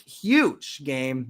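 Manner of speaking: HUGE stretched out for emphasis; announcing a big game, punch on HUGE; hyping it up. Real, not acted. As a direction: sell HUGE as a big deal. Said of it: "Not really huge game".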